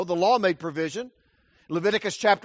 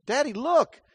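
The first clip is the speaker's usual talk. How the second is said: put-on higher, urgent child's call; child urging dad to look, higher; 8 semitones higher than usual. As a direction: tug at dad's sleeve. Child calling father's attention.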